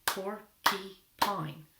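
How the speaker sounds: PORCUPINE very slowly in three separate beats; broken into syllables. Shown as a demonstration. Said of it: "We'll clap it out".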